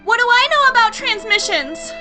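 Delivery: high, very loud retort; exasperated shout. Acted. Space Carry to her robot, in a frantic shout. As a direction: snap back in frantic frustration.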